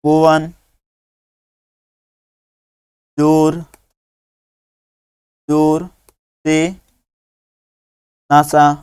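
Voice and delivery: spaced out word by word; dictation pace. Shown as a demonstration. Teacher dictating to children copying, slow and spaced. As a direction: give each word its own breath for writing. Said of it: "तो लिखो".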